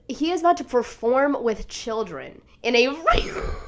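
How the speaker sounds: ordinary talk, nothing marked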